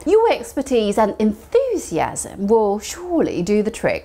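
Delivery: slow model read. Shown as a demonstration. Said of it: "slow model sentence".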